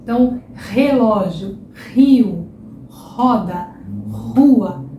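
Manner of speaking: relógio, rio, roda, rua each starting with an h-like R; strong R words. Shown as a demonstration. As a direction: list each word with an aspirated R. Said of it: "são todos sons com R forte".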